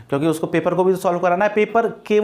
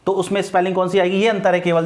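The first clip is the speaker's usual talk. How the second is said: ordinary talk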